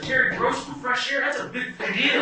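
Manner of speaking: high, thrilled disbelief; excited. Retold or imitated. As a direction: sound thrilled and impressed. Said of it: "I got excited and said".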